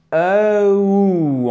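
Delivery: E ou drawn out, gliding from the first sound into the ou; gliding diphthong. Shown as a demonstration. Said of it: "on glisse sur le ou". (diphtongue glissée, « E ou » allongé en glissant sur le ou)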